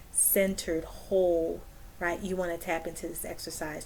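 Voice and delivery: normal talking voice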